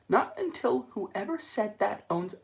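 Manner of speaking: slow, sulky nanny refusal; stern motherly computer. Acted. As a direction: dig in like an offended mother.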